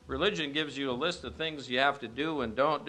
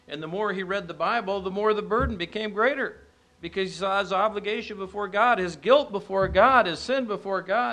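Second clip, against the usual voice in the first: building louder and higher through the list; rising preaching fervor; 7 semitones higher, 6 dB louder than usual. Acted. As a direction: preach it, swelling toward the peak.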